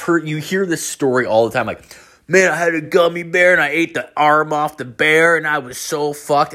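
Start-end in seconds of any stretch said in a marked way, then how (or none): 2.3-6.5 imitated stoner storyteller. Imitating people telling gummy story.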